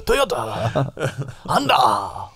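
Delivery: slow caricature Japanese brand names; mock Japanese accent. Shown as a demonstration. Demonstrating the accent rule, mock Japanese. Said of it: "it's just something like that".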